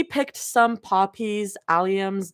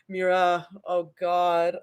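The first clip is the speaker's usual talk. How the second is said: plain reading voice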